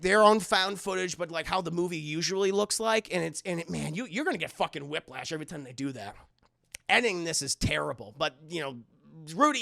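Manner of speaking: normal talking voice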